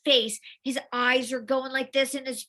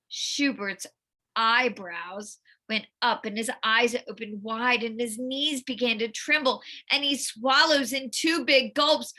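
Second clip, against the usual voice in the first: ordinary delivery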